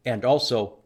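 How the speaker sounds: ordinary delivery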